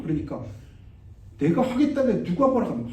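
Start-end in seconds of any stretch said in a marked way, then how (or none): 1.4-2.9 raised, bossy retort from the pushy man; puffed-up man shouting back; big loud voice. Retold or imitated. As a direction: throw your weight around, voice up and loud. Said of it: "아, 큰 소리 막".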